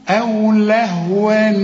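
أو لهوا ending in a clear drawn "ni"; tanween with kasra. Shown as a demonstration. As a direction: slowly show "lahwa-ni" in أو لهوا. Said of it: "اسمعها كويس". (أو لهوا بتنوين مكسور)

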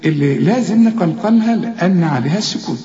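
ordinary talk, nothing marked

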